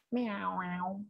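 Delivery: soft drawn-out kitty mewing; cat's meow. A narrator's reading. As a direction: mew quietly, like a cat. Voicing the cat in story.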